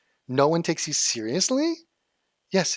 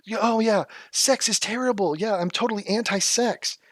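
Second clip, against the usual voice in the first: high, overdone mock agreement; sarcastic agreement; 6 semitones higher than usual. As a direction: say it dripping with sarcasm. Sarcastic agreement mocking critic.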